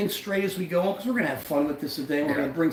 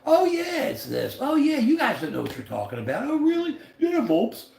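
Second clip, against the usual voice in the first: mocking bragging friends; 5 semitones higher than usual. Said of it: "our friends, they talk big".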